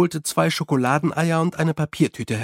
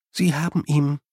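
ordinary delivery, nothing marked

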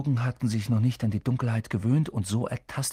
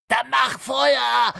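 slow, strained loud cry; trapped boy groaning a shout at the girl; 13 semitones higher, 7 dB louder, much slower than usual. Acted. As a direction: force it out under strain. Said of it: "ächzte Harry".

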